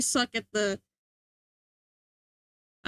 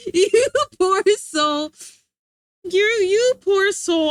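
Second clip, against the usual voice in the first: laughing pity; 6 semitones higher, 8 dB louder, slower than usual. Real, not acted. Laughing pity at artist's work.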